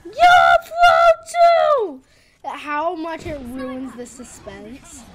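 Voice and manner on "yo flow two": high, loud, slow mock yell; quoted yell. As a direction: holler it like the trailer. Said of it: "where they're like".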